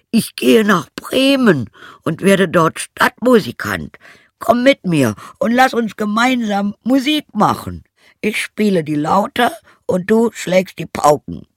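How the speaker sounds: high, loud donkey voice; rallying donkey character. Acted. Donkey invites the old hound to make music, rallying donkey voice. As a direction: call out the plan high and strong.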